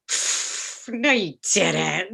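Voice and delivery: breathy pfft, then drawn-out dismissal; scoffing disbelief. Retold or imitated. Interns doubting her audition story, mock scoffing. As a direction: puff it away, then slow down the denial.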